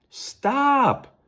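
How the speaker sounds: high, loud call from a distance; loud high cry of stop; yelling. Acted. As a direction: yell after them to halt. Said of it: "someone yelled".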